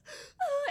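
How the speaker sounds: high, loud mock gasp; kids' dramatic gasp, imitated; imitated shocked gasp. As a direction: gasp in exaggerated horror. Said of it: "were just all like".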